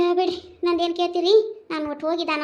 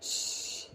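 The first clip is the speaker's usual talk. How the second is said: shush; 14 dB quieter, much slower than usual. Acted. Shushing mid-complaint.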